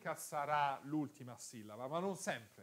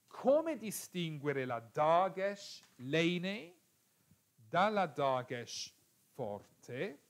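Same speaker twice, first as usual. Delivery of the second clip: slow emphatic question; 2 semitones higher, 3 dB louder, slower than usual. Shown as a demonstration. Posing the key question.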